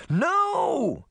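desperate shout; cry out. A narrator's reading. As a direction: stop the monster with a cry. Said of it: "Sabrina cried".

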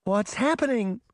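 high, startled question; alarmed cry. A narrator's reading. Daphne sees the mirrors shaking, crying out in alarm. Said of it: "Daphne cried".

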